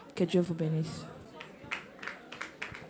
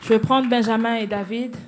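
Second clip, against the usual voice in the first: ordinary talk, nothing marked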